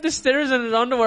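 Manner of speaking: ordinary talk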